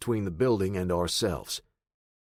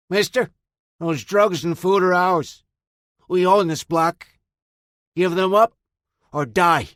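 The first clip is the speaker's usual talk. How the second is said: put-on loud, higher, slurring drunk thug; slurred thug voice; 9 semitones higher, 8 dB louder than usual. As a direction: bark it like a high street tough. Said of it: "The fool slurred his words".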